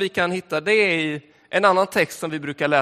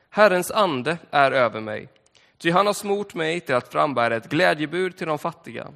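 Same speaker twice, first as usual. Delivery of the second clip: solemn reading from Luke; scripture reading; 3 semitones lower than usual. Acted. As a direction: read the Gospel passage to the congregation. Reads scripture aloud.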